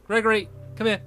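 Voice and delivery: high sing-song call to a pet; calling a dog. Real, not acted. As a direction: call him like a puppy.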